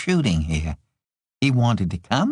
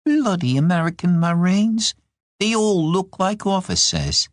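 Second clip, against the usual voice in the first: irritated, higher-pitched grumble; annoyed; 8 semitones higher than usual. A narrator's reading. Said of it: "she was annoyed".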